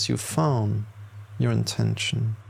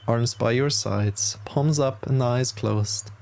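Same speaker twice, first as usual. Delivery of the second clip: ordinary talk, nothing marked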